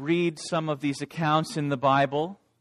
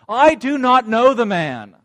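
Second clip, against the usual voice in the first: loud, higher, forceful denial; loud defiant denial masking a shaky voice; 5 semitones higher, 7 dB louder than usual. Acted. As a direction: bark it out to hide the fear. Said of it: "I responded with force".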